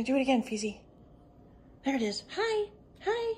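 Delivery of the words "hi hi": very high little pet greeting; cooing greeting. Real, not acted. Woman greeting the squirrel at the window, cooing high. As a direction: coo it high to a small animal.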